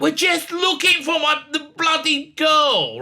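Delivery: high-pitched retold irritation; exasperated retort. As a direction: push back in mock exasperation. Retold exasperated reply to lecturing.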